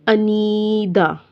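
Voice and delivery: अनीदा with a long drawn-out ee; slow अनीदा ending in aa, no n; lengthened vowel. Shown as a demonstration. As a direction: hold the ee in अनीदा, end on open "daa". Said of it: "ऐसे पढ़ना है आपने".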